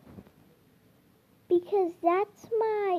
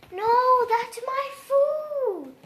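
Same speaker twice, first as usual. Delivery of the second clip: high, slow cry of protest; real protest; 9 semitones higher, slower than usual. Real, not acted. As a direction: stop him grabbing your food. Dog Joe grabbing her food.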